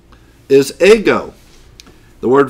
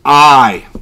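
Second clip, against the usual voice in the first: "I" drawn out slowly and louder; stress on I; 9 dB louder than usual. Shown as a demonstration. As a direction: lean hard and long on "I". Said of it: "The idea is emphasis".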